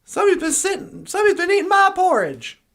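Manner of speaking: quick, indignant outburst; Papa Bear. Acted. Papa Bear finding his porridge eaten, lightly voiced indignation. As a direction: sound affronted, rushing the words. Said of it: "Papa Bear comes in and goes".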